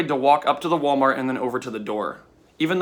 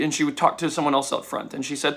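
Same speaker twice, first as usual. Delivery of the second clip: ordinary talk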